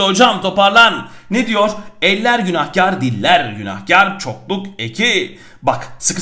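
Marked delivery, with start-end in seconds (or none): none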